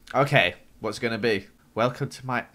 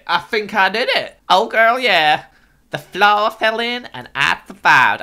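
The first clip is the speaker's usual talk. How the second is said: higher and louder, a hammed-up sassy diva; sassy put-on voice; 9 semitones higher, 10 dB louder, slower than usual. Gamer celebrating surviving the fall, in a sassy diva voice. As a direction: strut the win like a diva.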